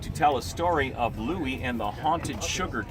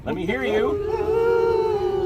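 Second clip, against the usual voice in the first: ghostly wail; 4 dB louder, much slower than usual. Shown as a demonstration. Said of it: "I'll teach it to you".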